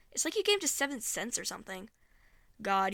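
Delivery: ordinary talk, nothing marked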